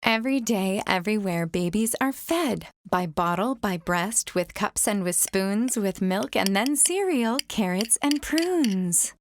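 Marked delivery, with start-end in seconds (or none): none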